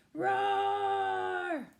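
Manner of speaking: loud roar. Acted. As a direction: bellow it like a grumpy bear losing it. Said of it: "That means it's loud".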